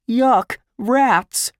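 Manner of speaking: high, loud yelp of disgust; disgusted cry. Acted. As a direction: cry out at something revolting. Boy disgusted by rats in cellar.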